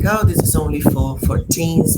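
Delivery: ordinary talk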